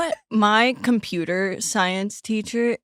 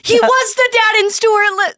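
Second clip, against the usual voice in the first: 1 excited; 14 semitones higher, 6 dB louder than usual. Real, not acted. Gushing over Stuart Little.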